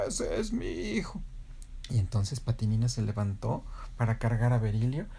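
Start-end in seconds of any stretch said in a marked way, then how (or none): 0.1-1.2 high, drawn-out, soft rooster boast; proud crowing rooster. Acted. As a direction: stretch it out like a distant crow. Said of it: "el papá de Berilio cantó".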